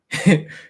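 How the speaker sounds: a quick burst of laughter; brief amused laugh; short laugh. Real, not acted. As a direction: laugh at the memory. Laughing at being ignored.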